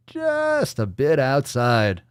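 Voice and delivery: Bob Uecker. Acted. Host quotes baseball movie commentator, drawn-out announcer call. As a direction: stretch the first word like a play-by-play announcer. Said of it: "Little Bob Uecker".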